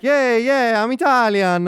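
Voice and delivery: high, loud, hammed-up Italian accent; mock Italian accent. Co-host teasing his friend's attempt at accents, mock Italian accent. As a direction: tease with an exaggerated Italian accent. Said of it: "your Italian accent would be like".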